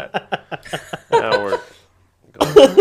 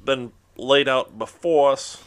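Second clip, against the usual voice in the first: saying grace; 2 semitones higher, 4 dB louder than usual. Pretend. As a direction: lead a solemn family grace. Mock grace at dinner, slow.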